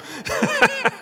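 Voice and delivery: high burst of real laughter; hearty laugh. Real, not acted. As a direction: laugh out at your own joke. Laughs at his own story.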